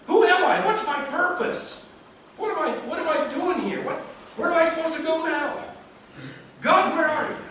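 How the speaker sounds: high, pleading questions to God; a touch of a bewildered exile; David's anguish. Retold or imitated. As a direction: cry out like a lost man asking God why.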